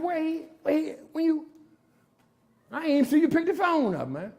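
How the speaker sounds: mock bewildered. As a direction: play yourself stunned the cops came. Stunned at police arrival.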